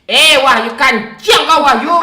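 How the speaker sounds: screaming at full volume. Real, not acted. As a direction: scream his name at the top of your lungs. Screaming at Wahyu.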